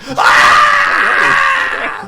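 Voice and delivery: very high loud dying shriek; death scream. Acted. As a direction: scream as the axe burns through. Thug dies screaming.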